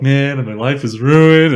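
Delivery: a half-joking mock wail of despair, drawn out; mock crying in shower. Retelling his shower cries to friends, mock sobbing. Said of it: "and I'll just bawl".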